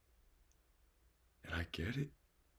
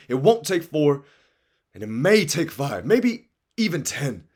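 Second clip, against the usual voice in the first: louder and higher, preaching to the crowd; motivational preaching; 7 semitones higher, 9 dB louder than usual. Acted. Urging dreamers not to settle, in rousing preaching. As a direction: fire them up like a preacher.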